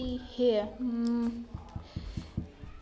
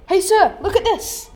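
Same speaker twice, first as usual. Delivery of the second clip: excited shout; 8 semitones higher, 11 dB louder than usual. Acted. Guard spots suspect on footage.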